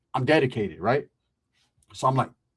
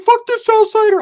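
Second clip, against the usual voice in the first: high shouted insult, way up in pitch; mock yell; 10 dB louder than usual. Retold Rick yelling at the outsider, high mock shout. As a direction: bark it out like an angry teen.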